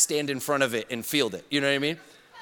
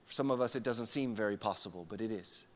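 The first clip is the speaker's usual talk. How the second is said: ordinary talk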